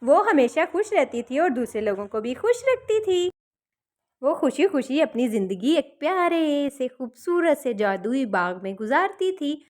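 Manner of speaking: ordinary delivery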